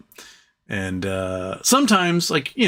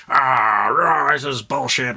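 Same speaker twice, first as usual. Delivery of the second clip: slow, strained mock growl of outrage; hammed-up tantrum; freaking out; much slower than usual. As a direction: growl and sputter like a furious customer. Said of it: "he's, like, freaking out".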